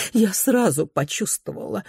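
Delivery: ordinary delivery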